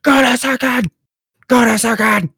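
high-pitched mock yell; shrill-pitched hollering, mimicking the other team; mock screaming. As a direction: imitate a tryhard screaming out plays. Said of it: "they're screaming, like".

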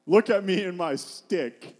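loud, slow mock boast; mock self-introduction. David presenting himself and his stick, mock boasting. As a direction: show off with hammed-up pride. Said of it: "He's not saying".

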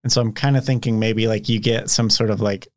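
ordinary delivery

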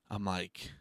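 usual speaking voice

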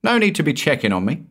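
high, fast called-out reply; shouting. A narrator's reading. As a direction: call it out quickly to his mother. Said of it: "he shouted".

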